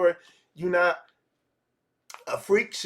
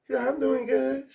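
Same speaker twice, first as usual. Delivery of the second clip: lightly voiced woman, pitched up; a quick higher reply as the woman; woman's reply; 7 semitones higher than usual. Woman answering a pickup line, pitched up a little.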